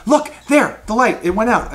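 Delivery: high, loud startled alert; alarmed goblin guard. Acted. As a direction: urgently point it out to your partner. Goblin guard spots light vanishing.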